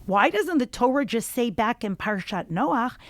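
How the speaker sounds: ordinary delivery, nothing marked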